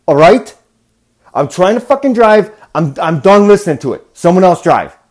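angry yelling at the passengers; retold blow-up. Driver yelling at chattering passengers, furious. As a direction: snap at them to shut up, voice climbing high and loud. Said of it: "And I just blew up".

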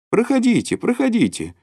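high-pitched, insistent invitation; inviting. A narrator's reading. Hostess asks Shubnikov to come in, inviting. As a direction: usher a guest in with a high lilt. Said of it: "Любовь Николаевна приглашала его".

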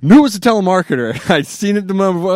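ordinary delivery

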